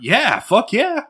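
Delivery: high, drawn-out fuck yeah; excited. Real, not acted. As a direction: cheer it out slowly. Enthusiastic agreement.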